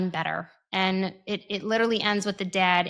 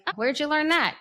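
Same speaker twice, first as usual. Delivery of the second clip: mock surprise; 7 semitones higher, faster than usual. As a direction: sound caught off guard. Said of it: "I was like".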